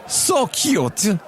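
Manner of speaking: high-pitched imitation of a Russian woman; Russian accent. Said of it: "the same Russian woman walks by".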